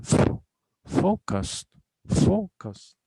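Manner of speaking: "focused" with a high, strong FO; stress on first syllable. Shown as a demonstration. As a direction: punch "fo", drop down on "cused" in "focused". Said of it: "stress is on the first syllable".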